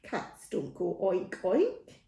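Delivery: ordinary delivery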